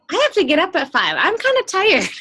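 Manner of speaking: high, fast whine about getting up at five; whiny tired complaint. Retold or imitated. Quoting herself to fired-up husband.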